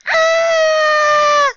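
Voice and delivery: put-on long, high, loud child's scream; falling scream. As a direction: scream as you tumble down. Child loses hold and falls.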